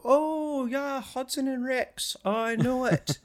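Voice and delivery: high, loud Newfoundland voice; Newfoundland local. Retold or imitated. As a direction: mimic a chirpy Newfoundland official. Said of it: "And they go".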